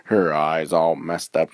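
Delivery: ordinary delivery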